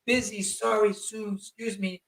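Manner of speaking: slow halting broken English, lightly imitated; a hint of a Japanese accent in broken English; mock broken English. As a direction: pick out each broken English word slowly. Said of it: "whatever broken English they know".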